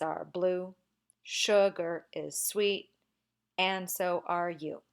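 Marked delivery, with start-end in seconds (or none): none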